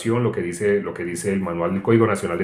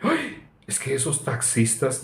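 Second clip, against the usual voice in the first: complaining student; 7 dB quieter, slower than usual. Retold or imitated. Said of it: "Y me hablan así".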